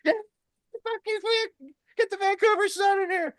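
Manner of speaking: very high, softened mock yell; imitated cheering crowd. Crowd backing the travellers at the border, high mock cheering. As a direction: mimic a rowdy crowd cheering from afar. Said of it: "the entire crowd's cheering".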